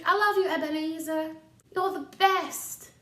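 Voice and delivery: hammed-up doting sister; adoring little sister. Sister gushing to young Scrooge.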